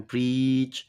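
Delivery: "प्रीच" as one drawn-out syllable with a long ee; pronunciation demo. Shown as a demonstration. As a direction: model "प्रीच" carefully, holding the vowel. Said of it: "इसको देखें, इस तरह बोलते हैं". ("प्रीच" की ई को लंबा खींचकर बोलें)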